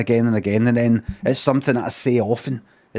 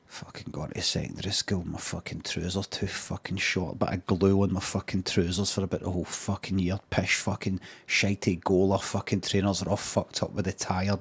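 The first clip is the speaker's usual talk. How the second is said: low, much softer muttered rant under the breath; mumbling to himself; 5 semitones lower, 10 dB quieter than usual. Real, not acted. Ranting to himself about school days, low soft mumble. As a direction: grumble it low and quiet, half to yourself. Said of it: "so I'm just mumbling to myself".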